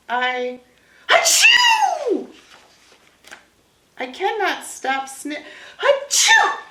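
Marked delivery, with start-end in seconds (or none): 1.1-2.3 high, loud exaggerated achoo; big sneeze. Gerald sneezes. 5.8-6.6 high, loud exaggerated achoo; big sneeze. Gerald sneezes again.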